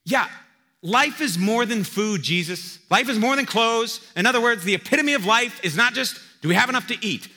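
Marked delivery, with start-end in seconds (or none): 0.0-4.0 loud, slow emphatic agreement; an audience voiced loud and high; crowd answering. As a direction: call it back like the whole crowd. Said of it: "his audience would have go".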